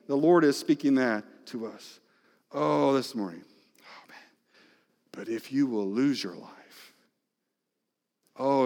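normal talking voice

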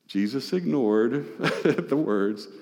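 normal talking voice

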